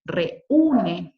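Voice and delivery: "Reúne" with re set apart from a loud ú; split into syllables. Shown as a demonstration. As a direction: punch the separate ú in "Reúne". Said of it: "sílabas distintas".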